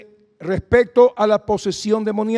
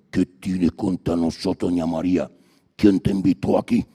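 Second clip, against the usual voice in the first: demon voice; 12 semitones lower than usual. Acted. Demon confronting doña María at the supermarket, low. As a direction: growl like a cornered demon.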